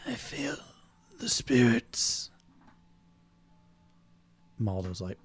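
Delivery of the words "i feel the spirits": eerie spirit voice. Acted. GM voicing ghostly figure.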